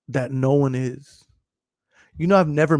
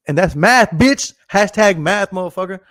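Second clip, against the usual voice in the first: loud, raised-pitch cocky punchline; loud boasting; 4 semitones higher, 8 dB louder than usual. Real, not acted. Streamer to viewers after doing sums, loud cocky brag. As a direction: crow it triumphantly. Boasting about his multiplication.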